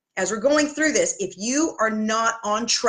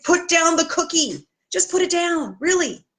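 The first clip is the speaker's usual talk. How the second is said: higher pleading coach, a touch hammed-up; exasperated plea; 7 semitones higher than usual. Coach pleading with a frustrated client, mock exasperated. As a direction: beg them to drop the cookie. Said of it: "all I wanna do is shake them and say".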